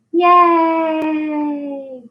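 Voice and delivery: high, bright cheer of yay. Acted. Teacher cheering children after the song, excited high yay. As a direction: celebrate like the kids just sang along.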